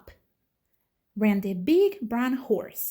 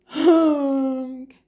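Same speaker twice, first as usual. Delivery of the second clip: loud, drawn-out horn blast; desperate honk; 3 semitones higher, 8 dB louder than usual. Acted. Reading aloud, the stuck dump truck honking. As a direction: honk for help.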